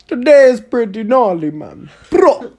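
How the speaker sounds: hammed-up Jamaican impression; loud, high, bad Jamaican accent. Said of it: "Imagine every Jamaican".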